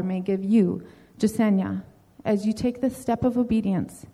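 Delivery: usual speaking voice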